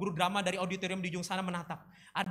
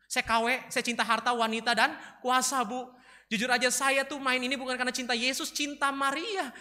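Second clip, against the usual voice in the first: raised, earnest excuse-making; confessing pupil; 6 semitones higher, 6 dB louder than usual. Acted. Brian confesses to his teacher, high and pleading. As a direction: own up in a higher voice.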